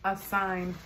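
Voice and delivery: eating between words; talking while chewing. Real, not acted. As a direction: mouth partly full of food. Seen 1: Bites burrito earlier; cheeks move and lips press while talking till 4.1.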